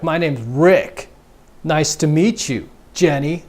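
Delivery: slow, earnest guy voice; dull chump. Retold or imitated. Chump introducing himself to girl, slow and earnest. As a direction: drag it out like an eager dud. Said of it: "And he'll be like".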